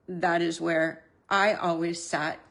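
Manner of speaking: usual speaking voice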